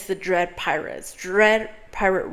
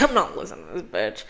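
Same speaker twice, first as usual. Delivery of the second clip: mock dismissive prince; 9 semitones lower, faster than usual.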